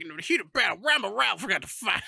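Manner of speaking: high dramatic game announcer; hammed-up battle narrator line. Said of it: "and he's like".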